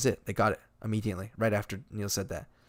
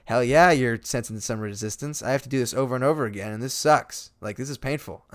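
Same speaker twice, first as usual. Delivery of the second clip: louder, slightly raised imitated complaint; retold frustrated outburst; fed-up retort; 3 semitones higher, 7 dB louder than usual. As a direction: push back at the director, exasperated. Fed-up actor snaps at director.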